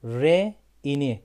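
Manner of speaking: Re ini split into syllables, slowly; slow breakdown. Shown as a demonstration. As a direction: spell out the sounds slowly. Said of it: "Y gitti ne oldu".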